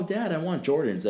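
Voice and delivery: ordinary talk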